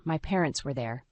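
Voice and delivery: usual speaking voice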